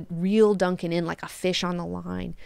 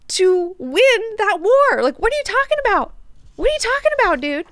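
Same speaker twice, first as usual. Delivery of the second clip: high, loud mock disbelief; hammed-up "are you kidding me" incredulity; incredulous outburst; 10 semitones higher, 5 dB louder than usual. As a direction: act out exasperated disbelief, pitched way up. Acted incredulous rebuke of Duncan.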